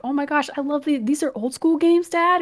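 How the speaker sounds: high-pitched imitation of a thrilled child; excited kid. Son marvelling to his dad at old games, imitated excitement. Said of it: "like, so excited".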